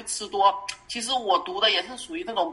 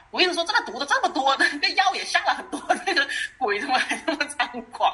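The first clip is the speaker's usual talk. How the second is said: higher-pitched voice breaking into laughter; amused laughing; 5 semitones higher than usual. Real, not acted. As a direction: laugh through the complaint as you say it. Joking about poisoning the devil.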